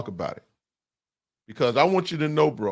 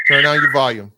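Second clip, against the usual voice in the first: louder, insistent repeat of the request; louder firm repeat; 6 dB louder than usual. Real, not acted. Host repeating himself to a caller who can't hear, louder and firm. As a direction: raise your voice so the caller finally hears.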